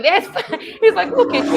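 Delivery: laughing through a high, amused reply; laughing dad. Retold or imitated. Dad laughing at his daughter's refusal, high and amused. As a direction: chuckle at your daughter while you answer. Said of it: "And he was like".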